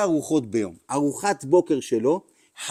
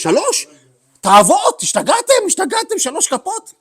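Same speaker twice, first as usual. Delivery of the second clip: high, loud mock outrage; outraged protest; 7 dB louder than usual. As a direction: act shocked, much higher and louder. The rebbe outraged at three spoons. (מחאה נרעשת בקול גבוה)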